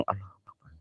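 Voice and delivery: hushed, breathless Allahu Akbar; whispered prayer. Real, not acted. Takut, berdoa berbisik. (doa berbisik ketakutan)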